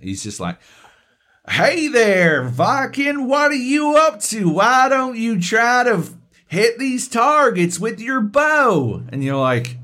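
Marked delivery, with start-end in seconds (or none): 1.5-9.0 high, loud, hammed-up greeting; mock a hearty, miscast game character; accentless game character. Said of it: "not even attempting to do a fucking a-, accent".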